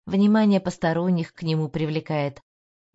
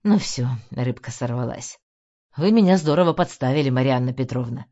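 irritated; 5 semitones lower, 3 dB louder than usual. A narrator's reading. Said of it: "и он бросил".

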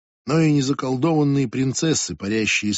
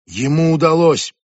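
panicky, louder outcry; near panic; 4 semitones higher, 6 dB louder than usual. Acted. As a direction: blurt it out close to panic. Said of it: "В голосе Ребекки едва не паника".

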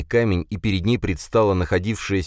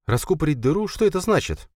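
higher, puzzled woman repeating the phrase; puzzled question; 11 semitones higher than usual. A narrator's reading. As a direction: repeat it back, baffled.

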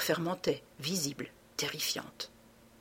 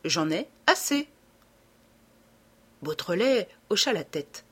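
plain reading voice

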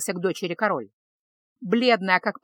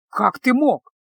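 louder, pained outburst; hurt anger breaking out; reproachful; 3 semitones higher, 5 dB louder, slower than usual. Acted. Betrayed king reproaches. (с горьким упрёком, громче)

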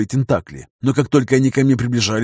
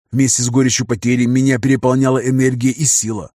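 ordinary delivery, nothing marked

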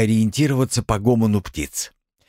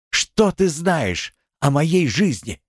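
bitter outburst; 8 semitones higher, slower than usual. A narrator's reading.

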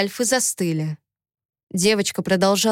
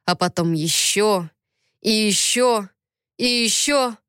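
plain reading voice

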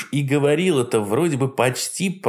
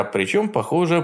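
plain reading voice

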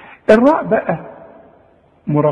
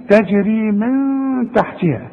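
slow chanted تجري من تحتها with nasal hum on من; slow recitation; 4 semitones higher, slower than usual. Shown as a demonstration. Teacher modelling the verse for a student, slow chant. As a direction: draw it out like a reciter.

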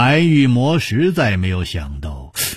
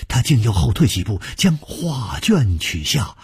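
ordinary delivery, nothing marked

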